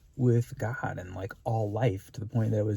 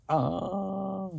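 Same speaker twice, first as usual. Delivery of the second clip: pained gasp; 9 semitones higher than usual. Retold or imitated. Acted overwhelmed exclamation.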